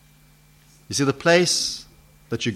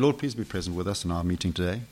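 mock glib, quick and softer; glib prayer; 6 semitones lower, 8 dB quieter, faster than usual. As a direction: rattle off the prayer lightly, without thought. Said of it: "We say that so glibly".

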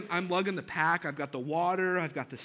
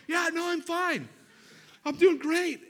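much higher, louder, strained forced cheer; husband overcompensating to his wife in a pinched voice; fake fine; 12 semitones higher, 7 dB louder than usual. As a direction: insist you're great while clearly dying. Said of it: "And she's like".

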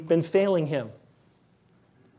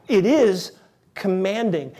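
ordinary talk, nothing marked

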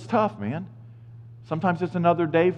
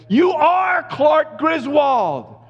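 much higher, loud and very slow proclamation; hammed-up teasing declaration; playful shout; 12 semitones higher, 4 dB louder, much slower than usual. Real, not acted. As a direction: announce it like a mock verdict. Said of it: "teasing the overachiever".

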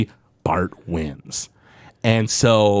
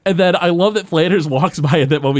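usual speaking voice

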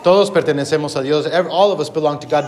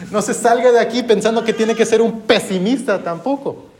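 higher-pitched, starting on a laugh; amused; 7 semitones higher than usual. Real, not acted. Preacher jokingly warns congregation against leaving as pessimists, amused. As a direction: chuckle into the line, pitched up.

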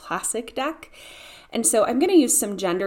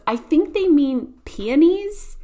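higher, louder, slow and drawn-out mom; mom's explanation; 8 semitones higher, 7 dB louder, slower than usual. Retold or imitated. Mom explains the misspelt sign to her kids, high and drawn-out. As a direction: voice mom spelling out the correction, pitched up. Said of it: "And my mom goes".

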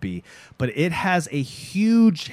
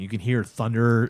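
ordinary delivery

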